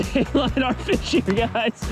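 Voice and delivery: ordinary talk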